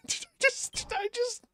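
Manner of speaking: very high, strained through laughter; laugh-squeaked question. Real, not acted. Laughing after dropping character.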